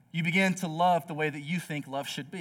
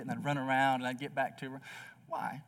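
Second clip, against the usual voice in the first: usual speaking voice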